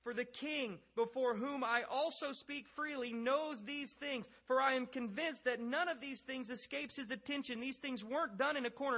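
high, emphatic reading of Paul's speech; scripture reading. Acted. Paul addressing the king before the court, emphatic declaiming.

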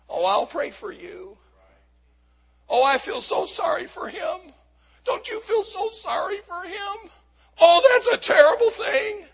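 hand-wringing fake concern; mock sympathy. Mocks passive sympathy in acted quotes.

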